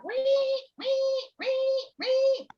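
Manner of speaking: high squeaky alarm wee wee; high-pitched beeping imitation; imitated alarm beeping. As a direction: mimic a shop alarm in a high voice. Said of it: "I hear".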